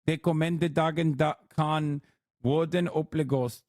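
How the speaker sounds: Dutch. Shown as a demonstration. Streamer demonstrating Dutch to viewers, slow careful Dutch. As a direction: read it out slowly and carefully in Dutch. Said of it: "this is Dutch".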